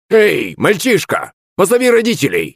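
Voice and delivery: put-on high-pitched old man calling out; shouted call. A narrator's reading. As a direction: cry out high to a boy at the gate. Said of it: "крикнул он мне".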